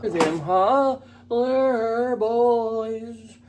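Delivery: high, very slow hammed-up sexy croon; mock sexy voice. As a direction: drag it out, mocking his sexy voice. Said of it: "How sexy his voice was".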